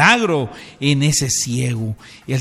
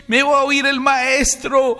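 high, loud cry with long-held vowels; insistent shouted plea; 13 semitones higher, 4 dB louder, slower than usual. Acted. Silenced blind man calls Jesus again, shouting for mercy. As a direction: call out defiantly so the master hears. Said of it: "cuando él gritó otra vez".

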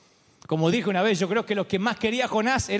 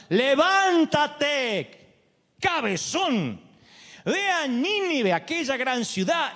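slow, high, stretched divine command; very slow commanding voice of God; 5 semitones higher, much slower than usual. Acted. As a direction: call Jonah like a booming God. Preacher voicing God commanding Jonah.

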